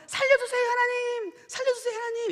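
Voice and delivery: man hanging from a branch, crying out in panic; desperate cry. Retold or imitated. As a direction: beg for your life, voice breaking. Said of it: "이렇게 외쳤답니다".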